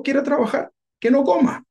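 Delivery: emphatic high quote. Acted. Pastor quoting Paul's rule emphatically.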